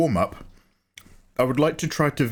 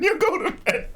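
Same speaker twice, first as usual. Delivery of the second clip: squeaked high through a laugh; laughing repeat. Retold or imitated. Streamer repeats to his friend, high and laughing. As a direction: giggle it out, much higher. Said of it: "I was just like".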